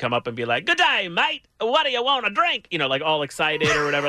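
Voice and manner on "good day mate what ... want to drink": high, hammed-up Aussie waiter voice; fake Australian accent. Imagined Outback waiter greeting diners in fake Australian accent. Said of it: "do fake Australian accents".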